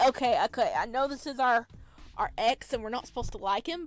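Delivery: ordinary delivery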